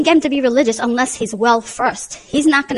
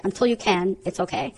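gentle reassurance; 4 semitones lower, 7 dB quieter than usual. Retold or imitated. Mother reassuring struggling son.

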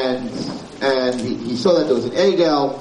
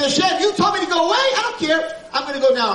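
raised, brazen insistence; defiant Moshe; 12 semitones higher than usual. Acted. Moshe telling Hashem he will pray anyway, brazen and raised. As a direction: plead back to God with nerve. Moshe defying God's command.

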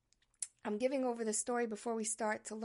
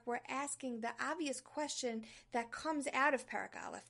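ordinary talk, nothing marked

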